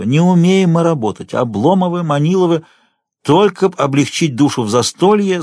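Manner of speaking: usual speaking voice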